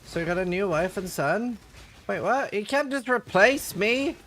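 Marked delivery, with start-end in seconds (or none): 2.1-4.2 mock outrage. Streamer protesting to chat in mock outrage. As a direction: play wounded at being replaced.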